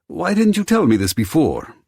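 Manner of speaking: high, loud angry demand; upset outburst. Acted. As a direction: snap at him in alarm. Upset at hidden danger.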